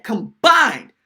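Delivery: "combined" stretched slow and loud; stress on combined. Real, not acted. Host repeating the punter's total, "combined" heavily stressed. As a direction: hammer the word "combined", drawing it out. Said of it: "I'm gonna stress that word again".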